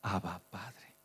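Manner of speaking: hushed intimate call to a father; tender whisper. Acted. Child calling softly to his father, tender hush.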